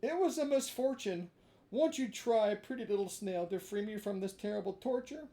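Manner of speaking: put-on high, loud begging puppet boy; high desperate marionette voice; pleading Pinocchio. A narrator's reading. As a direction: beg the snail to free him.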